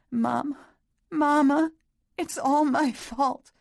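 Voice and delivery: high, loud crying for mama, slowing at the end; childlike crying. A narrator's reading. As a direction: sob it out like a guilty little girl. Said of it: "babbled and cried and blamed herself".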